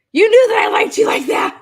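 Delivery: high, loud hissed accusation; mock accusation. Friend accusing her days later, high hissed mockery. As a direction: hiss the accusation in a high mocking voice.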